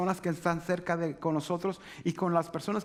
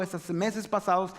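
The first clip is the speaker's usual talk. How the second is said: usual speaking voice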